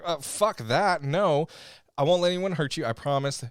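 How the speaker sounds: emphatic mock-dramatic protest; defiant refusal. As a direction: refuse hotly, in character. Acts defiant character in story recap.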